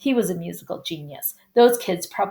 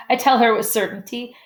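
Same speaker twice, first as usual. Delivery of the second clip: usual speaking voice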